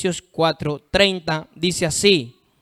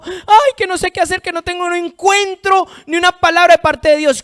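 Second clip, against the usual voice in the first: high-pitched, hammed-up whining lament; mock tearful complaint; 10 semitones higher, 5 dB louder than usual. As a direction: imitate a believer wailing to God. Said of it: "Después uno está llorando". (queja llorosa exagerada)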